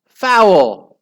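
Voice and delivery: high, very loud outraged yell; scream. A narrator's reading. Team appealing against a goal, screaming. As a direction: scream for the referee's whistle. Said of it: "screamed Margaret's team".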